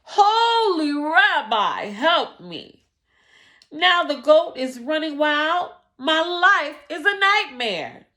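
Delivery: high, loud, slow screaming plea. Acted. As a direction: scream it out in despair. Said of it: "He said it again with a scream".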